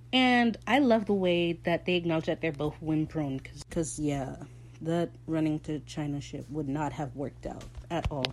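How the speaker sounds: usual speaking voice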